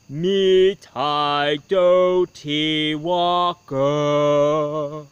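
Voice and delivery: slow, drawn-out spooky fireplace voice; eerie slow chant from the fireplace; low sad ghost voice. A narrator's reading. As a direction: moan it out like a ghost from the chimney. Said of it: "Softly and sadly, he says".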